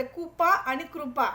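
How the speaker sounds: कुपा then कृपा, slow, the second with a full कृ; contrasting pronunciation. Shown as a demonstration. Teacher contrasting कुपा and कृपा for students. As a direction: set कुपा against कृपा slowly. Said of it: "उच्चारण नीट लक्ष द्या".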